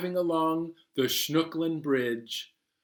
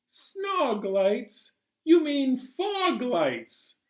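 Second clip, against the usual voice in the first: puzzled question; 10 semitones higher, much slower than usual. Acted. Narrator questioning.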